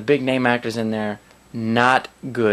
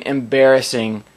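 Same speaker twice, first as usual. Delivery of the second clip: normal talking voice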